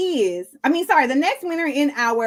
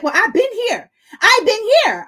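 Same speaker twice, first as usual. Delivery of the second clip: mocking the FedEx man; 8 semitones higher, 3 dB louder than usual. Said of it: "He's like".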